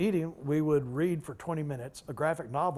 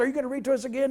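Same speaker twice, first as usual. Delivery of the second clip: put-on eager kids; 10 semitones higher, 5 dB louder, much faster than usual. Little boys asking eagerly.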